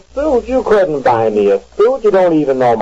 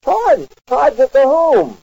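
shocked; 10 semitones higher, slower than usual. Acted. Husband shocked at charge account.

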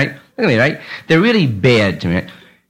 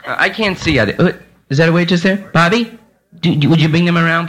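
ordinary delivery

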